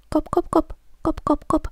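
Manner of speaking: high, light tapping kop sounds; bridge going kop. A narrator's reading. Bridge echoing the tiny goat's steps, high tapping. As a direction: tap out the little hooves on the bridge. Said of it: "sanoi silta".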